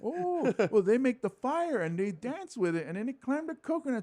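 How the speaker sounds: high-pitched marvelling sightseer; hammed-up tourist wonder; mock tourist. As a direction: gush in a high voice like a wowed visitor. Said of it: "they have tourists walk through like".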